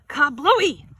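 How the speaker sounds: loud burst word; big exclamation. Acted. Narrator bursts out as the water balloon pops, loud. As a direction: shout the splash.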